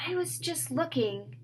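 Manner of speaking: breathy whispered excuse; mild dazed whisper; weird voice. Acted. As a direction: sound strangely distant. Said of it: "Mallory's voice was weird".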